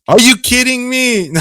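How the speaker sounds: very high, loud mock-incredulous cry; hammed-up high-pitched disbelief; excited catchphrase. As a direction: squeal it like a signature catchphrase. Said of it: "And Avo's is".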